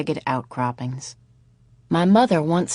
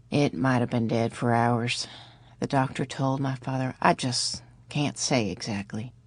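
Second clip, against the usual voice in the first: low doctor voice; 5 semitones lower, 6 dB quieter than usual. A narrator's reading. Doctor reports dead baby.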